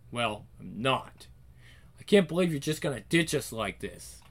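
low, soft, sorrowful inner voice; hurt, private reproach. Acted. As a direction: murmur it to someone who can't hear you. Girl silently addresses dying grandfather.

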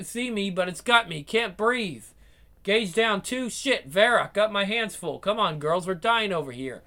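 ordinary talk, nothing marked

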